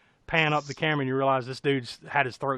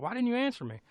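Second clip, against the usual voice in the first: high-pitched acted complaint; high, indignant question without volume; reproachful question; 9 semitones higher, 4 dB quieter, faster than usual. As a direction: imitate a put-out boyfriend, pitched up. Acting boyfriend's reproachful question.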